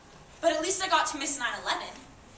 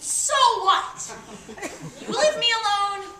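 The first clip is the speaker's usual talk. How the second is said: raised, higher indignant retort; defiant outburst; 5 semitones higher, 6 dB louder, slower than usual. Acted. Gloomy spirit tells critics off, defiant. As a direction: push back loudly at the critics.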